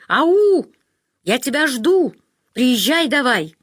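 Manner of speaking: loud, high cheerful calling. A narrator's reading. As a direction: shout it down the phone line. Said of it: "позвала из трубки".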